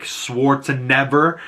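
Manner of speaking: Schwarzenegger ending in "never"; punning mispronunciation. Retold or imitated. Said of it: "So instead of Schwarzenegger".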